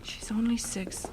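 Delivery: hushed, aching whisper; grieving whisper. Acted. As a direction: whisper it to yourself. Grieving mother repeats lament.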